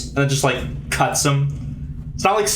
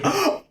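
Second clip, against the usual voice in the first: mock screaming from the warp; imitated scream. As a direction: shriek like a withered man in terror. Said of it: "just screaming".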